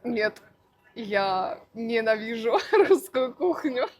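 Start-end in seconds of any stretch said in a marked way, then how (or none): none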